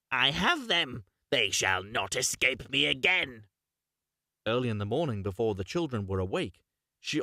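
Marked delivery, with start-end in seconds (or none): 0.1-3.4 mocking witch. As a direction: crow over your trapped prey. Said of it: "and said mockingly".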